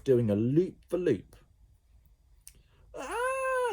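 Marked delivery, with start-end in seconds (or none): 3.0-3.7 very high joyful scream; scream of delight. A narrator's reading. Ned on the loop, high joyful scream. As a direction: shriek with fun. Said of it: "he screamed again, but this time in pleasure".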